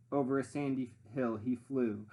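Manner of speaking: plain reading voice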